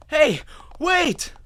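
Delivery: loud, high call to stop her; shout. A narrator's reading. Narrator yelling for the pony to wait. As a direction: yell after her as she runs.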